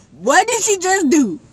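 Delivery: high loud stunned question; shocked disbelief. Retold or imitated. Friend storms off, I ask in loud shock. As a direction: blurt it out in shock.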